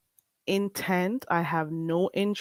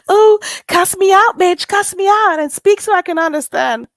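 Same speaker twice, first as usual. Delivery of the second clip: higher and louder, a mocking imitation of her taunt; mocking that woman; 12 semitones higher, 8 dB louder than usual. Mimicking a rival streamer's taunt, high and loud mockery. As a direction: ham up the woman daring her to cuss her out. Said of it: "that woman over there with her freaking".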